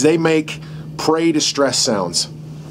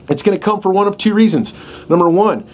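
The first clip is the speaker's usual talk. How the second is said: usual speaking voice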